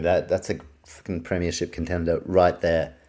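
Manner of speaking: usual speaking voice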